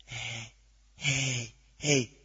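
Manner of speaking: soft, low calls; mock timid voices. Preacher imitates what head voices don't say, meek soft calls. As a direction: call out meekly, barely audible. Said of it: "They are not saying".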